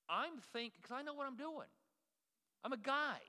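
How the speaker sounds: high-pitched cocky self-parody; self-mocking bravado. Pastor mocking his own handyman confidence, self-mocking bravado. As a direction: push the pitch way up, boasting in mock certainty.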